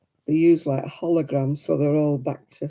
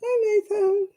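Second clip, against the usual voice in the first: high greeting; slower than usual. Real, not acted.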